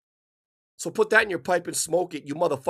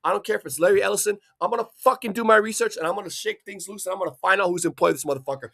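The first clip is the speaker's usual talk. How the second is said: ordinary delivery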